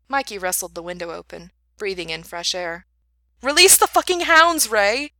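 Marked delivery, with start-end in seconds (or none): 3.4-5.1 furious yell, much higher and louder; shouting. Acted. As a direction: bark the order across the room.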